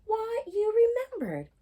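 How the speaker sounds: high, loud, touched exclamation of mother; delighted surprise. Acted. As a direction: sound truly surprised and moved.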